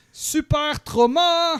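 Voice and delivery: big superhero name shout. Pretend. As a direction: yell it out like a hero reveal. (cri de super-héros)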